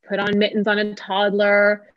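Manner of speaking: usual speaking voice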